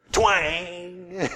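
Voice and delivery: nasal twang sound, high; mock twang noise; sung twang. Shown as a demonstration. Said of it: "it also has a vocal style".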